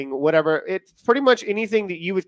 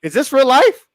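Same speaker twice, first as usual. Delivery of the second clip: amazed; 8 semitones higher, 7 dB louder than usual. Pretend. Mock amazed question.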